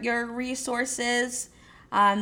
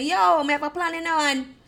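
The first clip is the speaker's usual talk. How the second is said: higher Jamaican patois, louder; 7 semitones higher, 5 dB louder than usual. Retold or imitated. Speaker mocking a friend's excuse, in a higher Jamaican voice. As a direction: Jamaican accent put on for a friend. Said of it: "I'm gonna go Jamaican on you guys".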